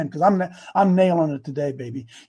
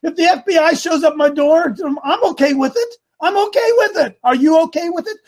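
excited defiance; 9 semitones higher, 4 dB louder than usual. Real, not acted. Preacher defies fear, laughing.

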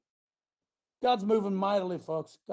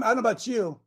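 usual speaking voice

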